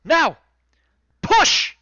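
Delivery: high, loud command to push; yell. Acted. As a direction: yell the crew into action. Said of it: "and he yells".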